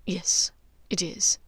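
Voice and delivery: plain reading voice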